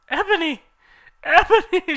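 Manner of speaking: high, loud calling out; sad calling. Acted. Draco calling after Ebony as she runs, high loud sad call. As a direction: call after her in despair. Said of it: "shouted Draco sadly".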